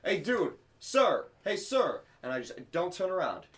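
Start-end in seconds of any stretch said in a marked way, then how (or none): 0.0-2.0 high-pitched mock calling out; imitated street calls. Imitating a stranger calling after someone who won't turn, calling out. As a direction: keep trying for a passer-by's attention, pitched up. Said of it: "I hear someone yell".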